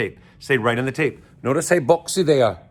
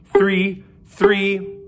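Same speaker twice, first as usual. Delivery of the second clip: excited count; 6 semitones higher, 5 dB louder than usual. Shown as a demonstration. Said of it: "We're gonna go like this".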